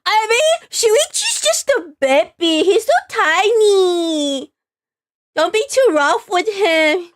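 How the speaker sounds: put-on doting baby talk. Doting over baby Shuichi.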